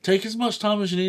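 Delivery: a high, fast, lightly voiced sympathetic chorus; quick cooing sympathy, lightly done; imitating sympathetic fans. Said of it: "Everyone's like".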